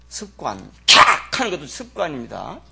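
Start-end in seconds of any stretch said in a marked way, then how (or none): 0.9-1.4 loud, sharp breathy "캬" snarl. Retold or imitated. Pastor mimics the sister's biting snarl, a sharp hiss. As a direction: snap out a snarl like a biting dog.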